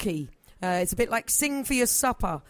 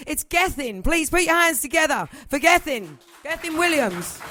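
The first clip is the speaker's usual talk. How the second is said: higher, louder host introduction calling the act up; stage announcement; 7 semitones higher, 4 dB louder than usual. Real, not acted. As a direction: bring him on like a compère hyping the crowd.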